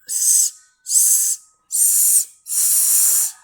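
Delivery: "S" as a long hiss of air; unvoiced S sound. Shown as a demonstration. Teacher quizzing the class on S, sustained voiceless hiss. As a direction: hiss "S" without any voice. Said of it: "Voice or unvoiced?".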